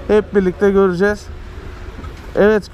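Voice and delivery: normal talking voice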